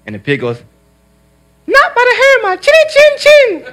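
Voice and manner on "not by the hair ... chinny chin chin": very high, tiny piglet voice laughing through it; high piggy squeak with a laugh; squeaky little pig. Acted. Pig answers the wolf.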